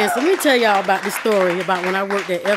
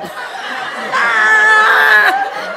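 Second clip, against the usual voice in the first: big high cackle at his own joke; 11 semitones higher than usual. Real, not acted. Comic laughing at his own insult to the crowd.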